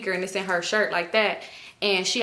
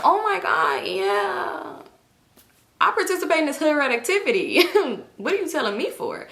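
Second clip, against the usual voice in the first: mock bougie girl; 6 semitones higher than usual. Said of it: "I'm supposed to be like".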